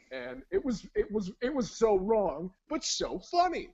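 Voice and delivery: high, loud, climbing gush; gushing boast. Retold or imitated. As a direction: build to a loud, high finish on the joke. Acting the oblivious best friend.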